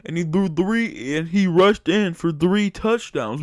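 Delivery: a hint of a doubting fan defending him; mock critic voice. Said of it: "I know what you're saying".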